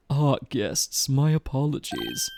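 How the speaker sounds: lightly snooty, slow and proper; a hint of a haughty bellman. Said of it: "There we go, snooty voice".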